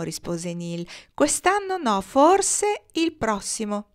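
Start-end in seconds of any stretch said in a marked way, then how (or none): 1.1-3.9 very high-pitched call, slowing at the end; high, drawn-out answer; called-back reply. A narrator's reading. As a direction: call it down from far above. Nils calls back to the children.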